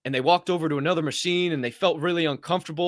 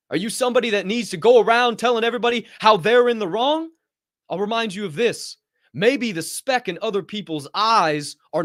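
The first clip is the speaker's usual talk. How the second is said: raised higher, righteous scolding; indignant confrontation; 6 semitones higher, 3 dB louder than usual. Retold or imitated. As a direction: call him out, how dare you. Retold confronting the old man.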